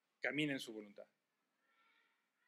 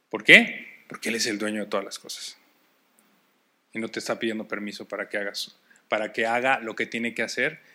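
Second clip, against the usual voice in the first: shouted, much louder preaching; fiery preaching; 6 semitones lower, 19 dB louder than usual. Acted. Pastor exhorting the church not to end like Jonah, shouting. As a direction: drive it home to the whole room.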